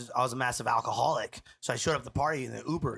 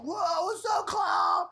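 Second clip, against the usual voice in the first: party-crowd shout; 13 semitones higher, much slower than usual. Retold or imitated. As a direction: hail the newcomer at a wild party. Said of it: "they're all".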